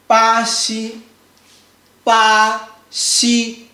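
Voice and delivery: BA XI, both syllables long and level; first tone. Shown as a demonstration. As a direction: hold ba and xi flat on the same pitch. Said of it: "make sure it's flat".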